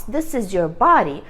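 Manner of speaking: ordinary talk, nothing marked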